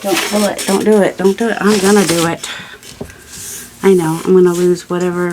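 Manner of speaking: ordinary delivery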